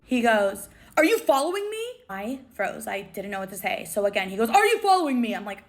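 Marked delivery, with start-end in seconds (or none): 1.0-1.8 high-pitched, accusing mock yell; retold angry yell. Celebrity confronting the fan behind him, mock angry yell. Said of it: "this man yelled at me". 4.5-5.3 sharply raised, accusing question; retold angry yell. Celebrity repeating himself to a frozen fan, mock angry yell. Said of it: "this man yelled at me".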